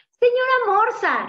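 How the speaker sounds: high, loud chick calling out; excited high-pitched hail; exclaiming. A narrator's reading. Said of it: "exclamó".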